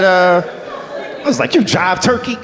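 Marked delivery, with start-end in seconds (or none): 1.5-2.4 high-pitched mock jive retort; dated jive talk. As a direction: throw it back in hammy old-TV jive.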